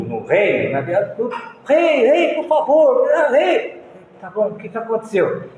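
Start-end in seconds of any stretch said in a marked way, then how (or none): 1.7-3.8 raised begging calls to the king; pleading crowd. Retold or imitated. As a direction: beg the king urgently.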